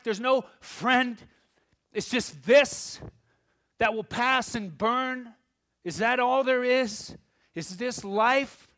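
loud, high, impassioned sermon voice; full-force preaching. Real, not acted. Pastor preaching to the congregation, loud and impassioned. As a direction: preach it out with rising fire.

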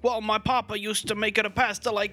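hammed-up Italian-American dad boasting about pasta; Italian-American owner bragging to Gordon, mock accent. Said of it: "the guys are like".

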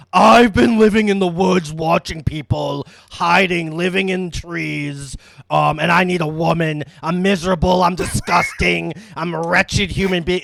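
loud, hammed-up lament; overacted monster monologue. Retelling the monster's monologue, loud and hammy. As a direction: wail it like a tragic monster. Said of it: "basically like".

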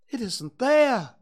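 a friendly, eager little bear; cheerful bear Pooh. Acted.

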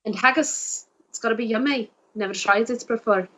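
put-on Scottish accent. Shown as a demonstration. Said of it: "I love a good Scottish accent".

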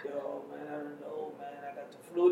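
quiet, weak fake-sick voice. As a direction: play sick on the phone. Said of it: "you put the sick voice".

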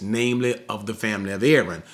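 normal talking voice

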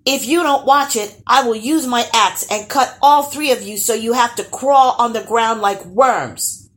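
loud, high threat shouted back; defiant yell. A narrator's reading. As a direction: keep shouting it boldly to the end. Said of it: "yelled Evan back".

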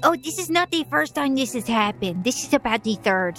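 ordinary talk

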